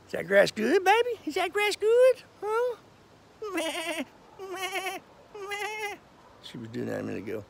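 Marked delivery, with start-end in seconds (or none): 1.2-2.8 high cutesy pet voice. Real, not acted. Asking goat about grass. 3.5-5.9 high soft bleating meh; goat bleat. Pretend. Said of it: "Guess we might learn to speak goat".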